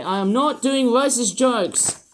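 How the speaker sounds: indignant outburst, each word driven home; exasperated protest. Pretend. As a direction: protest loudly, pitch climbing. Irritated protest at background backlash.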